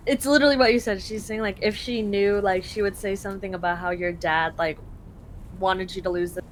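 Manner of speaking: ordinary delivery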